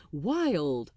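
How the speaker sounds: plain reading voice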